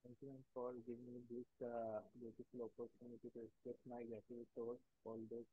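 very faint, distant voice; barely audible. Real, not acted. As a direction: far too quiet, as if the mic is off. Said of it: "You're not audible?".

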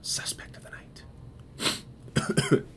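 very slow hushed whisper for the reveal; dramatic whisper. Acted. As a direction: draw it out in a breathy hush, building suspense. Dramatic reveal of final suspect.

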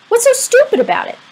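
high, loud indignant comeback. A narrator's reading. Boy defending his crush to a mocking friend, indignant. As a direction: shoot back, stung and high-pitched. Boy stung at being called stupid.

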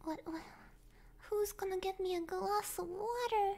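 ordinary delivery

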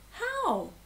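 high, small questioning voice; young warthog's innocent question; little warthog. A narrator's reading. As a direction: ask it high like a puzzled child. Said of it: "replied Aut".

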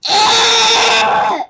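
high, loud yelp; mock yell. [name] acting out his own yell for viewers, loud high yelp. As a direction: let out a sudden loud yelp. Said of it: "why you gotta yell".

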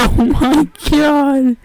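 high, slow shriek of shocked laughter; laugh-scream at the shock. Real, not acted. Shocked gamer shrieking with laughter at a death.

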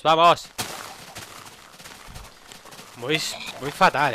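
loud exasperated yell; frustrated shout. Real, not acted. Frustrated lag, yelling.

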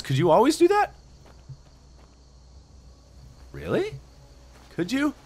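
surprised. Real, not acted. Surprised by new game option.

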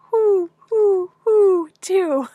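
high, loud owl hoots; owl hooting. Retold or imitated. Reader repeats the owl's answer, hooting loudly. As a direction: hoot each word like an owl. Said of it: "The owl says".

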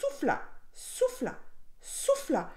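high, straining repeats; strained storytelling repetition; effortful blowing. A narrator's reading. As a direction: say each one as a puff of effort. Narrator mimics the wolf blowing.

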